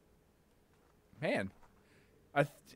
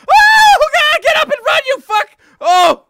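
much higher, loud frantic screams; frantic screaming; 13 dB louder than usual. Real, not acted. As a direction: scream at your character to flee. Frantic yelling to escape.

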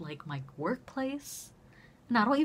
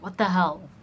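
louder, exclaimed disbelief; shocked outburst; 10 dB louder than usual. Retold or imitated. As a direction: retell a loud, stunned reaction. Acted shocked reply to coworker's news.

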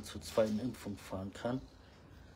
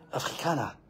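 calling out sharply; 4 semitones higher, 7 dB louder than usual. Real, not acted. Ruft den Kater zur Ordnung.

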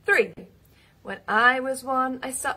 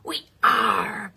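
pirate call; 3 semitones lower, 4 dB louder than usual. Pretend. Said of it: "can you give me a big "We are".

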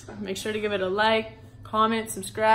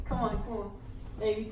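ordinary talk

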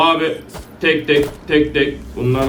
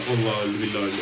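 relieved hush; 7 semitones lower, 9 dB quieter, much faster than usual. Real, not acted. Relieved after boss dies.

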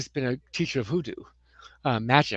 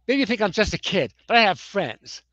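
mock aggressive kid; 4 semitones higher, 7 dB louder than usual. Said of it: "I got more aggressive, more demanding".

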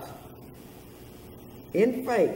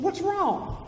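wife asking; 11 semitones higher than usual. Retold or imitated. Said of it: "She said".

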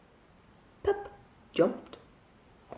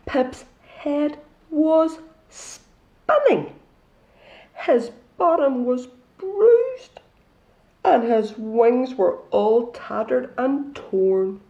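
dramatic storytelling; 4 semitones higher, 7 dB louder, much slower than usual. A narrator's reading. Dramatic aftermath of fall.

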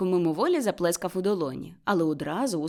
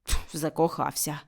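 lower, scornful mutter; scornful grumble; 4 semitones lower than usual. A narrator's reading. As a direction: dismiss it as nonsense. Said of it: "пробурчав Скрудж таким тоном". (зневажливе бурчання)

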